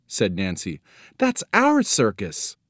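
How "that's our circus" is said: slow, high-pitched realisation; dismayed girl. A narrator's reading. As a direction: draw it out high, in alarm. Girl realises her circus is endangered.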